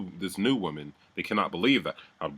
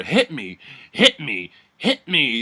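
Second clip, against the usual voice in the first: rising mock yell, goading; imitated yelling; 5 semitones higher, 5 dB louder, slower than usual. Ellie goading Deborah upstairs, imitated yelling. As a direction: imitate a repeated taunting shout, building higher. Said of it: "yelling to Deborah".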